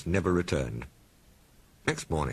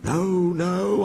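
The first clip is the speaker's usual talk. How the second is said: scared; 13 semitones higher, 5 dB louder than usual. Acted. Toby voiced scared.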